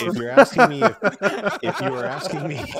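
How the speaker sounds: can barely hold back laughter while answering; laughing. Real, not acted. Cohost answers the Pikmin question while breaking up laughing.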